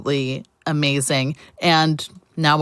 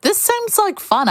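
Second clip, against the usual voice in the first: bright, eager inner voice; 13 semitones higher, slower than usual. Retold or imitated. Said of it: "you thought to yourself".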